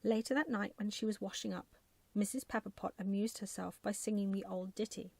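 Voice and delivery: ordinary delivery, nothing marked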